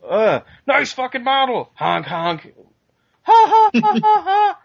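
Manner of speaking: squeaky high cartoon clown voice, cackling; silly clown. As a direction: ham it up like a goofy clown honking. Mocking Beetlejuice's goofy gags.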